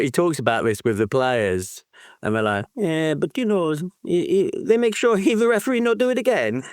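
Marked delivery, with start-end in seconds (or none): 2.8-6.6 mock Italian accent, higher and quick. Podcaster quoting Italian players in a mock Italian accent. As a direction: play an Italian fan shrugging it off in an Italian accent. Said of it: "that Italian accent".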